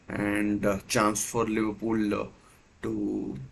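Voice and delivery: usual speaking voice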